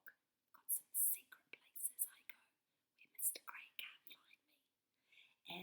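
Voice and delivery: secretive whisper; hushed secret hiding-place whisper. Acted. Secret hiding line in poem.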